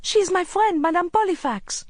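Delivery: put-on high, loud boy's protest; urgent, high-pitched young boy; crying out. A narrator's reading. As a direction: cry it out in defence of a friend. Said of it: "cried Hafez".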